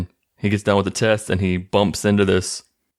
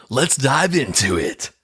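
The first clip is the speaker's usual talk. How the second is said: slow, higher-pitched mocking podcast intro; mock cliche host; 5 semitones higher than usual. Host mocking his own cliche episode start, hammed-up. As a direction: parody a podcast host's opener, drawn out. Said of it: "the most cliche way possible to start an episode".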